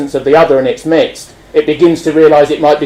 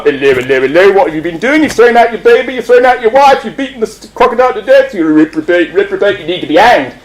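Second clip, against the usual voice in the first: policeman; 2 semitones higher than usual. Acted. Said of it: "the policeman is one".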